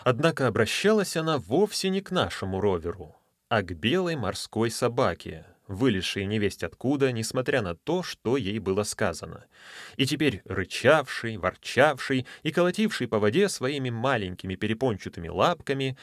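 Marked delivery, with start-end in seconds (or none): none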